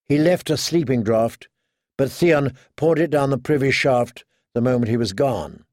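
usual speaking voice

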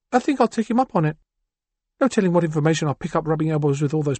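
a cool woman planning her escape; Chrysalis. Acted.

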